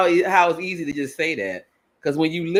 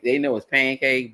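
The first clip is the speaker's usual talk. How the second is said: normal talking voice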